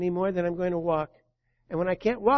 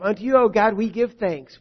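ringing, raised proclamation; proclaimed loud; 4 semitones higher, 10 dB louder than usual. Real, not acted. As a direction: call it out to the congregation. Psalm verse proclaimed.

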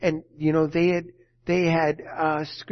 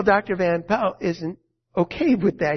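ordinary talk, nothing marked

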